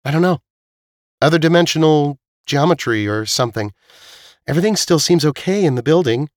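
high-pitched character voice, unsure; uncertain Zila. Acted.

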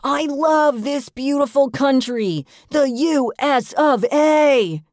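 high, slow patriotic bellow; shouting. Acted. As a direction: belt it out to the whole room. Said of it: "shouts at the top of his lungs".

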